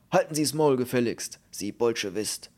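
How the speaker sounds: loud, angry officer's rebuke; bellowing. A narrator's reading. Officer silences an insolent prisoner, bellowing. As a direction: roar it to shut him up. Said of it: "brüllte er".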